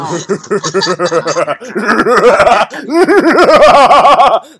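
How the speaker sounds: loud drawn-out cackle; evil laugh. Pretend. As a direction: cackle like an unhinged villain. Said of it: "who can do the most evilest laugh".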